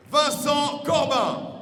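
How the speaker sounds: slow, loud, drawn-out name; booming ring announcer. Real, not acted. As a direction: stretch the name out for the crowd. Ring announcer calls fighter name.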